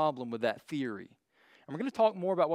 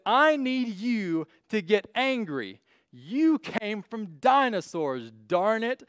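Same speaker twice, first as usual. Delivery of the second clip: slow, higher put-on cartoon bird voice; angry bird leader; 4 semitones higher, 4 dB louder, much slower than usual. Cartoon bird rallying the calm birds, slow, higher put-on voice. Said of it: "And he says".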